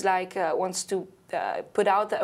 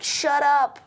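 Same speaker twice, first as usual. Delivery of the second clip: loud, higher mock yell; imitated scream; 6 semitones higher, 6 dB louder than usual. Singer imitates annoyed people at her street session, screaming. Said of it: "And they were screaming".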